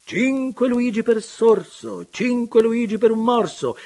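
high, loud mock town-crier call; playful auctioneer. Filippo jokingly shouting like a crier at the charity fair. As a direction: bark it out like a fairground hawker. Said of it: "imitando, per gioco, la voce di un banditore".

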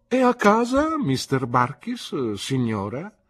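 cool, formal question with a high opening, slowing on mister Barkis; feigned distant tone. Pretend. Pretending not to know his old nurse, distant and formal. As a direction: pretend you're a stranger at her door. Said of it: "simulando un tono distante".

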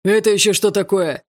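loud stern challenge; stern watchman catching kids. Acted. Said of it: "услышала я суровый голос".

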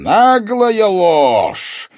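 slow, high, roaring accusation; enraged villain interrogating intruders; thundering sorcerer. A narrator's reading. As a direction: draw out each angry word at full volume. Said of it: "прогремела под сводом".

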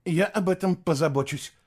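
high, constricted reply; strangled voice. A narrator's reading. Boy promises to handle it, voice strangled. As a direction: squeeze the promise out. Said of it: "сдавленно проговорил Фло".

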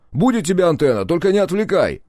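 high, harried retort; exasperated shout. A narrator's reading. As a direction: shout back to be left alone. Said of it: "выругался он".

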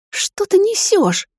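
high, slow hiss with rising fury. A narrator's reading. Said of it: "зашипела на него".

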